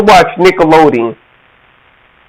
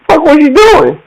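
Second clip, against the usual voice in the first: high, incredulous outburst; mock shocked cousin; 9 semitones higher than usual. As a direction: blurt it out in disbelief.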